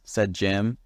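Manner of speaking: usual speaking voice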